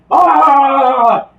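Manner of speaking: high loud scream. Retold or imitated. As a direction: cry out in alarm. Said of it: "you're like".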